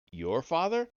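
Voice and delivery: sharp rising disbelief; high, puzzled echo of the word; surprised question. A narrator's reading. Narrator questions the Muon, surprised.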